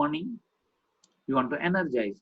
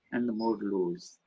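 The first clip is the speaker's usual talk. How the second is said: "more lows" dropped low, soft and slow; pitched down; 4 semitones lower, 4 dB quieter than usual. Shown as a demonstration. Trainer acting out falling pitch, low and slow. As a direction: sink down on the lows. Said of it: "So what is nice?".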